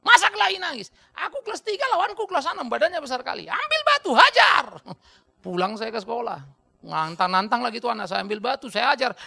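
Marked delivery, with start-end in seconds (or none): 0.1-0.9 high loud mocking father; scoffing father. Father mocking son for crying after fight, loud scoff. 1.2-4.6 put-on high loud boyish account; excited boy retelling. Boy telling father how he fought, high excited. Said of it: "Berkelahi aku".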